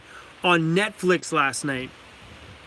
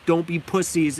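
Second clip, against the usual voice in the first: ordinary talk, nothing marked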